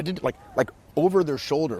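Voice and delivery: usual speaking voice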